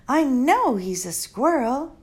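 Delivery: lightly voiced exasperated girl; a hint of girlish impatience; Flora. Girl exasperated at the old doctor, mild.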